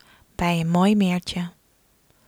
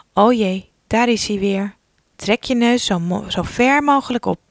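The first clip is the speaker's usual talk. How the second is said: louder playful urgency, rising; urgent alarm; 5 dB louder than usual. Pretend. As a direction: hurry the child playfully. Fly returns in story.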